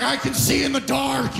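high mock boast; hammed-up high-pitched brag; wild-eyed welder. Imitates the glossy-eyed welder.

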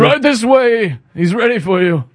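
mock European royal voice; European prince voice. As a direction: impersonate a foreign prince ushering someone in. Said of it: "sounded like a prince in Europe".

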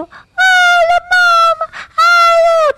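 silly high greeting. Shown as a demonstration. Co-host shows the wrong way to greet her dog, squealing high. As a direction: overdo the hello like squealing at a baby. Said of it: "no sé qué, no".

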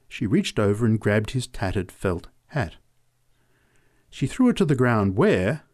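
plain reading voice

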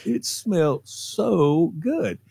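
very slow, dreamy drawl; slow savoring. Retold or imitated. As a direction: stretch it out lovingly. Drawn out savoring repeat.

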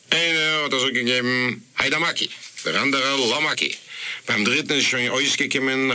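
plain reading voice

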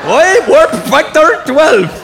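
slow, drawn-out mock Scottish accent; a lightly hammed-up Scottish engineer; a hint of Scottish accent, slowed way down; Scotty's Scottish accent. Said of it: "Scotty in Star Trek".

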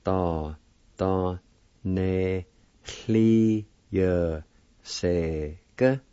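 "Do" "do" "ne" "hli" "ye" "se" "ge" with pauses between; syllable by syllable. Shown as a demonstration. Teacher sounding out the word phase, syllable by syllable. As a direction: sound out each syllable separately. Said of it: "It's pronounced like this".

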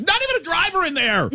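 outraged high-pitched retold yell; imitated yelling. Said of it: "she stands up and starts yelling".

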